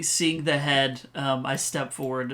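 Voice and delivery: ordinary talk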